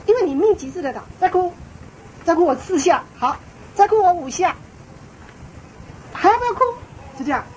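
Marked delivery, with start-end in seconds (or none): 1.2-6.6 raised-pitch mock threats, ending higher; stern parent escalating. Shown as a demonstration. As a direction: demonstrate rapid warnings to a crying child. Demonstrating escalating threats to child. (示范家长步步紧逼地警告)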